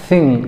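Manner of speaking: थिंग opening on an airy th, not a stop; native th sound. Shown as a demonstration. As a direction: say थिंग with tongue between teeth, air flowing out. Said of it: "क्या बोलेंगे?".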